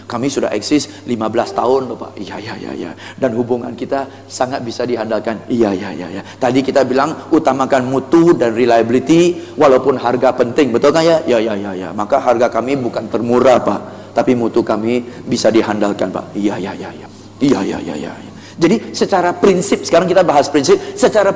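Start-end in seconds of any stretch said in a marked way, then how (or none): 2.1-2.9 low, soft, mumbled yes-yes of a customer; passive customer agreeing. Acted. Customer nodding along. 5.5-6.2 passive customer agreeing. Acted. Customer nodding along. 11.3-11.9 passive customer agreeing. Acted. Customer nodding along. 16.4-18.3 low, soft, mumbled yes-yes of a customer; passive customer agreeing. Acted. Customer nodding along.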